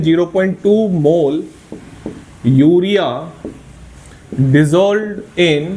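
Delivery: teacher modelling how to read the notation, very slow; slow spelled-out reading. Shown as a demonstration. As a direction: read "zero point टू mole urea dissolved in" very slowly, word by word. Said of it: "ऐसे पढ़ा जाता है इसको".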